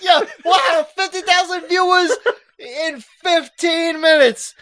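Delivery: shouting with laughing disbelief; excited shout. Real, not acted. Cohost seeing fifty thousand viewers, excited laughing shout. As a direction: yell it out, thrilled and cracking up.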